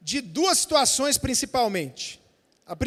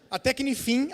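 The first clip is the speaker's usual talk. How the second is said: usual speaking voice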